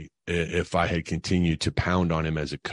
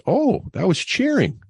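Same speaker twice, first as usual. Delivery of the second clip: put-on high, slow, amazed kid voice; a child realizing something, drawn out; imitating his son's surprise; 5 dB louder than usual. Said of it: "he goes".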